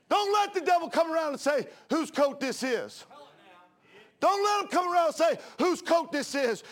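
loud, rising preacher's cadence; preaching at full force. Acted. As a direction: preach it out to the congregation.

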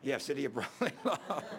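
real amused laugh in the words. Real, not acted. Preacher joking about Philadelphia's nickname, laughing. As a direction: chuckle through the ironic aside.

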